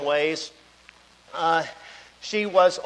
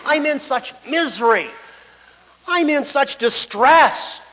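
louder, pleading cry of a man in anguish; voicing anguished Job; 5 semitones higher, 6 dB louder than usual. Preacher voicing Job to his friends, impassioned and louder. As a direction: speak as Job, bursting with pain. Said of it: "he says".

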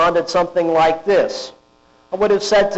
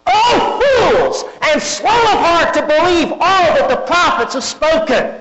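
much higher, louder exclaiming rebuke; exclaimed rebuke; 11 semitones higher, 3 dB louder than usual. Retold or imitated. Jesus rebuking the two disciples, high exclaiming dismay. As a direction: cry out at the slow disciples in dismay.

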